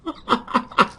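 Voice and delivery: soft chuckle in short bursts; quiet, pulsing laugh. Real, not acted. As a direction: chuckle at your own joke. Laughs at statue joke.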